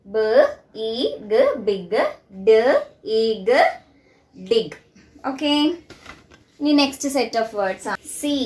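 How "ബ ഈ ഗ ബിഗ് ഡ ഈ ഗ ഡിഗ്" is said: "ബ, ഈ, ഗ" one sound at a time, then "ബിഗ്"; low, spaced sounds blended into "ബിഗ്" and "ഡിഗ്"; phonics sounding out. Shown as a demonstration. Teacher shows the sound of I in big and dig, sounding out. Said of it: "ഐ എന്നുള്ള ലെറ്ററിന്റെ sound എന്താണ്?".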